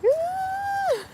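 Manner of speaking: high mortified squeak; a squeak of panic; embarrassed squeak. Retold or imitated. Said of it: "I was like".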